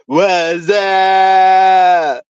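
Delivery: high, loud, drawn-out goofy holler; movie catchphrase shout. Acted. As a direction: yell it and hold the last vowel long. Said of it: "Two thousand screen movie back in the nineties".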